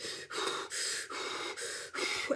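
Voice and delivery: brace yourself with big deep breaths; deep steadying breaths. Shown as a demonstration. Woman readying herself at a door, deep steadying breaths. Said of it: "I was like".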